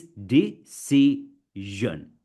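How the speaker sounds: DECISION broken into de, ci, sion with long gaps; syllable-by-syllable. Shown as a demonstration. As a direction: stretch "decision" slowly, one syllable at a time. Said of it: "you need to break a word".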